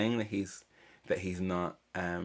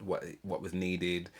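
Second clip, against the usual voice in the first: ordinary delivery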